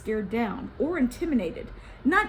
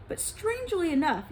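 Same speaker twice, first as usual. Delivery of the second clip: ordinary delivery, nothing marked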